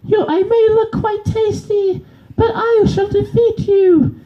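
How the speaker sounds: very high, cartoonish squeaky fish; high squeaky fish rapping back. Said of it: "versus a fish".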